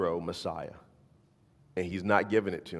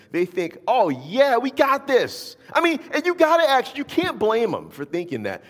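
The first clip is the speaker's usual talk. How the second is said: much higher, louder, driving sermon voice; fired-up preaching; 12 semitones higher, 8 dB louder than usual. Acted. Preacher firing up the congregation about the Messiah, loud and high. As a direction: preach it with rising energy.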